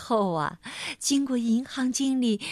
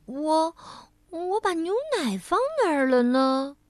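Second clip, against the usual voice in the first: lightly voiced muddled lady, higher; a forgetful woman puzzling aloud; a hint of a dithering lady asking herself; muttering to herself; 5 semitones higher than usual. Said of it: "她自言自语地说".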